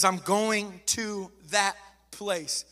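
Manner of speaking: drawn out word by word; slow emphatic quote. Acted. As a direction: stretch out "I'm going to that place" with weight. Repeats Jesus' answer slowly.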